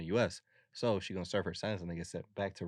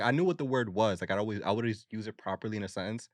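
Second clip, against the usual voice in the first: ordinary talk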